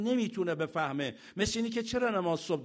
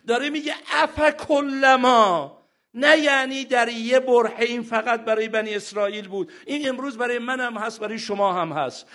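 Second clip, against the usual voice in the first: loud, urgent sermon voice; fiery preaching; 4 semitones higher, 7 dB louder than usual. Acted. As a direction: press the brothers and sisters hard. Preacher exhorts congregation.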